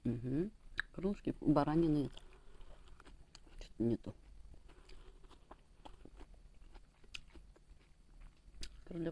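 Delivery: talking with mouth full; muffled speech between bites; chewing food. Real, not acted. Seen 2: Eats throughout; food goes to mouth, chewing with closed lips.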